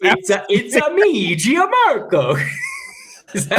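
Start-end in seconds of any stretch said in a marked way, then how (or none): 0.0-2.4 high, slow, shouted cartoon Italian; Mario-style Italian accent. Co-host teasing colleague about sounding like Mario, exaggerated Italian accent. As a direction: belt it out like Mario's catchphrase, pitched way up. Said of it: "You sound like Mario when you make an Italian".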